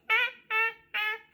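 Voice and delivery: high squawking gull cackle; seagull laugh. Retold or imitated. Imitating the seagull's laugh for her viewers, loud high squawk. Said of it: "they make this weird laughing noise".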